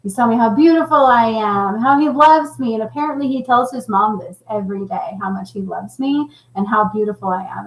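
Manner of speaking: ordinary delivery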